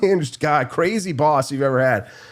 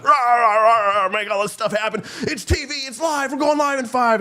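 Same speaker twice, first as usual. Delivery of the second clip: mock ranting boss; 12 semitones higher than usual. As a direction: ham up a frantic producer barking orders. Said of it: "they would get so mad at you".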